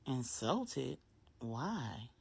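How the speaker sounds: drowsy, half-awake murmur; sleepy. A narrator's reading. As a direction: sound barely awake and soft. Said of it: "In a sleepy voice".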